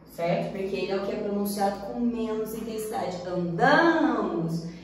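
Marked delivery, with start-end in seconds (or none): 3.3-4.7 "Andamos" very slow, DA stressed. Shown as a demonstration. Teacher pointing at the stressed A, stress on DA. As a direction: draw out "Andamos" leaning on DA. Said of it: "Esse A aqui, que é o tônico".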